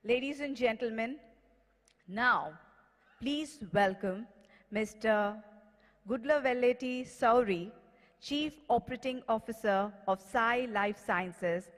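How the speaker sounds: ordinary talk, nothing marked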